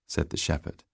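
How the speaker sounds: ordinary delivery, nothing marked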